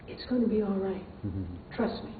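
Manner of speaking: soft and low, calming a frightened friend; reassuring. Retold or imitated. Grandmother calming Maria at the top of the wheel, soft reassurance. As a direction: gently reassure her it's safe. Comforting scared friend on ride.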